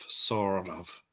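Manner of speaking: "sort of" in an American accent, the T flapped to a D. Shown as a demonstration. Teacher repeating the American "sort of". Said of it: "Now try to say it in an American accent".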